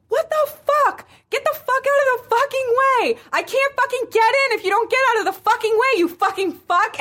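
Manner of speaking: high, loud mock screaming at traffic; imitated road-rage yell. Imitating a friend stuck in traffic, road-rage yell. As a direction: screech it like a driver leaning on the horn. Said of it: "she leans on the horn".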